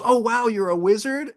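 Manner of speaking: high, humoring mock amazement; patronizing wonder. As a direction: imitate someone indulging a child's make-believe. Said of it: "they thought I was mentally challenged".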